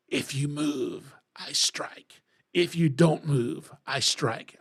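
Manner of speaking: slow, whispered menacing cobra; slow sinister whisper, a snake threatening a little boy; horrible snake voice. Retold or imitated. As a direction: hiss it out like a wicked cartoon cobra. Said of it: "see how horrible my voice just sounds?".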